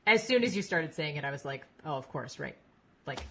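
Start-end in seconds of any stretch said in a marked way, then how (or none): none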